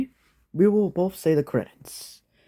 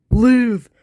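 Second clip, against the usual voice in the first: high, loud desperate cry; louder plea; 8 semitones higher, 6 dB louder than usual. Acted. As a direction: shout it as a last plea. Begging again, harder.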